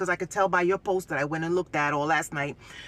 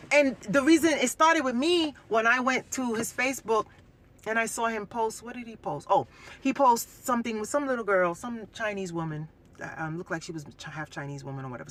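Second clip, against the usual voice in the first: usual speaking voice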